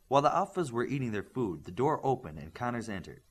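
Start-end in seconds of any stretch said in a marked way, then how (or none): none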